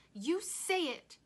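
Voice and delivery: high, loud, slow order; screamed demand. A narrator's reading. Rob daring his silent father, screaming. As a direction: demand it at the top of your voice. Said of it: "Rob screamed into the silence".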